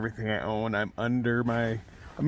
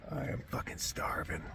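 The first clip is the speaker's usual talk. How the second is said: whisper; 7 semitones lower, 13 dB quieter than usual. Real, not acted. Starving alone in storm tent.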